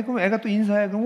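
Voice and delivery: normal talking voice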